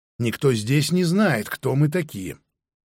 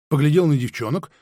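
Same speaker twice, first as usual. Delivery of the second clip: ordinary delivery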